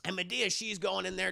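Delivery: normal talking voice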